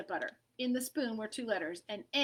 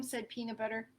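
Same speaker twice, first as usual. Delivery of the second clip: plain reading voice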